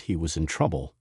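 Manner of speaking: plain reading voice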